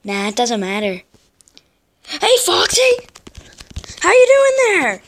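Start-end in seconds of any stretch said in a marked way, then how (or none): 2.1-5.0 very high, loud mock greeting; taunting call. Real, not acted. Player greeting his rival Foxy, high taunting call.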